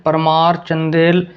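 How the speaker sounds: usual speaking voice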